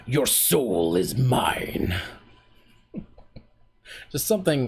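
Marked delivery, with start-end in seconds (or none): 0.1-1.9 slow, drawn-out menacing villain line; Mortal Kombat villain. Shown as a demonstration. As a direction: stretch every word like a game villain claiming a soul. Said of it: "that's from Mortal Kombat".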